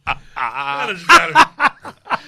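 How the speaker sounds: loud, high, helpless laughing groan; real uproarious laughter through the words; laughing loudly. Real, not acted. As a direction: crack up while protesting a terrible joke. Cracking up at the awful punchline.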